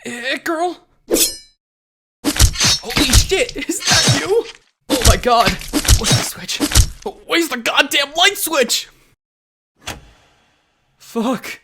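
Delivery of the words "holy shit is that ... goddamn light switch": high-pitched, frantic alarm; freaked out and casting about; panicked shock. Acted. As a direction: blurt it out in rising panic. Stumbles on shock in the dark.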